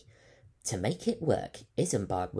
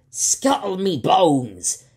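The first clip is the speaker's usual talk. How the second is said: loud, slow, higher pirate roar; 9 semitones higher, 15 dB louder, slower than usual. Acted. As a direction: bellow the curse at the room. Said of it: "he roared".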